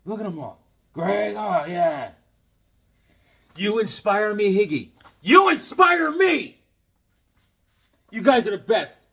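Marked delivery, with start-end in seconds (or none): 5.2-6.6 high, loud and slow; excited outburst. Real, not acted. Thanking the kids, shouted high and slow with joy. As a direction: belt it out with delight. Repeats the kid's card line.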